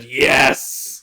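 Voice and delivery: loud triumphant cheer; excited yes. Retold or imitated. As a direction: cheer like your hero just showed up. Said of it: "the moments where I said".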